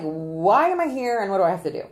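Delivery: loud, emphatic imitation of reluctant jurors; imitating jurors. Consultant voicing jurors who got summoned, loud complaint. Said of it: "They are like".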